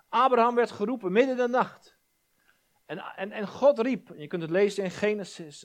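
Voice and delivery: impassioned preacher telling of Abraham; preaching at full force. Real, not acted. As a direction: build the story with rising fervour.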